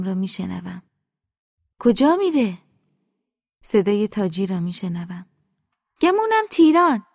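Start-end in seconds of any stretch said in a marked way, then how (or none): 1.8-2.6 high, louder question from offstage; mother calling. Acted. Mother asks the housekeeper where he's going, high and loud. 6.0-7.1 high-pitched, eager servant's reply; housekeeper answering. Acted. Housekeeper answers the master's call, high and obliging. Taji's voice heard answering mother.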